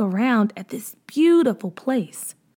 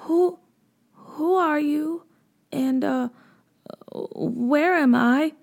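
timid; 5 semitones higher, slower than usual. A narrator's reading. Scared girl hiding behind a tree, timid and hesitant. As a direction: ask it shyly from behind the tree. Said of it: "McKinley said timidly".

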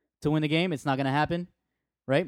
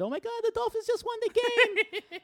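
far higher, squealing lady voice; excited lady. Retold or imitated. A lady telling him the Dolphins just won, shrieking with excitement. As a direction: play the thrilled woman breaking the news. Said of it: "some lady said".

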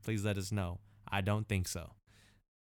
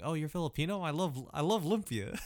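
higher-pitched imitation of an eager outsider; 6 semitones higher than usual. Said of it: "Why'd you do the voice, man?".

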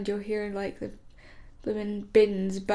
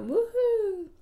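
excited cheer; 10 semitones higher than usual. Real, not acted. Cheering in intro.

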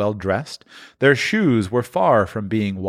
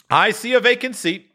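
higher, solemn spirit voice; the ghost; 13 semitones higher than usual. A narrator's reading. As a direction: foretell the empty seat gravely. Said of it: "replied the ghost".

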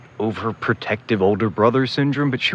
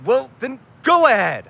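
snippy challenge; 13 semitones higher, 3 dB louder than usual. Acted. Bickering over tape recorder.

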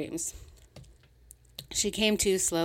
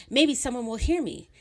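angry; 6 semitones higher, 4 dB louder than usual. Acted. Rising rage, repeated line.

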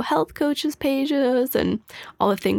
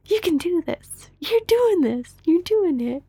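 higher-pitched encouraging cheer; 8 semitones higher than usual. Retold or imitated. Retelling how she cheered on a sick loved one, encouraging cheer. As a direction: cheer them on, voice lifted without shouting. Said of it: "cheering him on".

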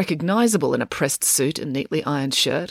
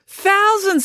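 very high, loud disbelief; aghast; 5 dB louder than usual. Acted. April hearing the crowd size, aghast. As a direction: exclaim it, aghast.

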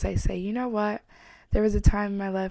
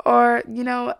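ordinary delivery

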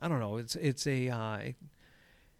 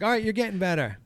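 higher mock encouragement; pitched-up pep talk; coaching boyfriend; 8 semitones higher, 4 dB louder, faster than usual. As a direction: imitate an amateur coach praising a beginner. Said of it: "he is teaching her".

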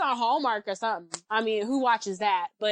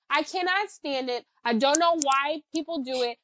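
ordinary delivery

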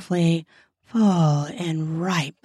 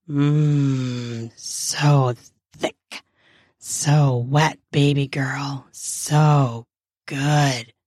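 low man's voice, half moaning; low, halting murmur that slides into a moan; 5 semitones lower, much slower than usual. Acted. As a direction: murmur it low, pausing between phrases. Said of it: "he murmurs in a moan-like voice".

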